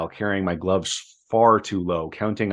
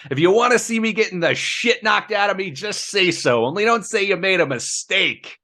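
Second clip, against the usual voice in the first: a high, savage retort; 11 semitones higher than usual. A narrator's reading. Ernest bitter at Scott over the long round, savage. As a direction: hurl it back at him with contempt. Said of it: "Ernest said savagely".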